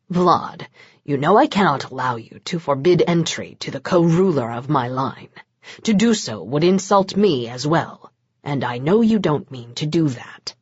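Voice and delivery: low, soft, patient parental tone. A narrator's reading. Mencaries calms Vlad like a parent, low and patient. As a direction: soothe him like an errant child. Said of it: "the patience a parent used".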